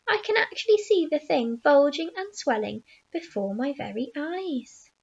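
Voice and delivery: plain reading voice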